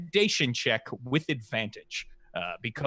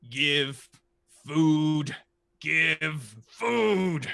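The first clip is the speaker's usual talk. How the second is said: 1 dragon voice; much slower than usual. Acted. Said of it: "and says".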